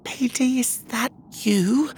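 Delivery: high, hesitant female character voice; quiet voice. Acted. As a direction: ask slowly, hardly daring to hope. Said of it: "came a quiet voice".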